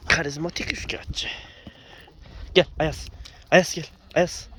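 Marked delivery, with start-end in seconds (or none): 2.5-4.5 loud call across the field; calling out a dog. Real, not acted. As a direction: shout the dog's name to come.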